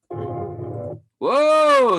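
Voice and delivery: very high, loud falsetto whoa; mock amazed whoa. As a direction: gasp it like you've seen magic.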